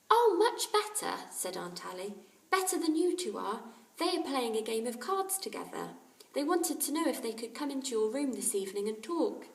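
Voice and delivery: kindly mother. A narrator's reading. Aunt Ally checking on the sick boys, caring. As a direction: fuss over the sick boys.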